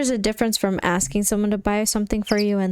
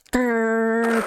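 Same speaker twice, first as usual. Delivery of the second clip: drawn-out voiced tiger growl. Tiger closing in on the pair, drawn-out growl. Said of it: "I do a great impersonation of a tiger".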